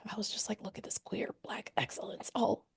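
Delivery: awed hushed whisper. Retold or imitated. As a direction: breathe it out in awe. Whispered awe at queer excellence.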